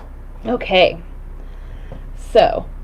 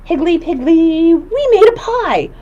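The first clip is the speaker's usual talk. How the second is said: excited singsong; 8 semitones higher, slower than usual. Shown as a demonstration. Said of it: "Can you guys say Higgly Piggly".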